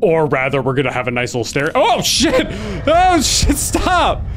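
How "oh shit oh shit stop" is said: much higher, startled shout. Real, not acted. Player scared by Santa, real startled shout. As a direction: yell as he jumps at you.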